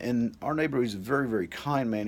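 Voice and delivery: ordinary talk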